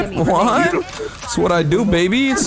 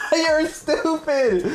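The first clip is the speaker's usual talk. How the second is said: high, slow, laughing through it; stretched out while cracking up; laughing insult; 12 semitones higher, 3 dB quieter, much slower than usual. Real, not acted. Laughing at teammate.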